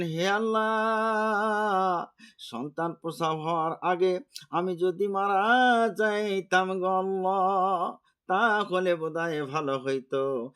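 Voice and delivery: high, drawn-out chanted plea to God; preacher's sing-song imitation of crying; tearful lament. A narrator's reading. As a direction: wail it melodically as the weeping mother's prayer. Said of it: "তিনি কাঁদতে লাগলেন গো".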